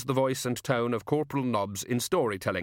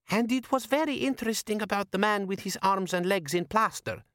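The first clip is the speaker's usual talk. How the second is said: high, different-sounding woman's voice; higher character voice; 10 semitones higher than usual. A narrator's reading. Natal speaks.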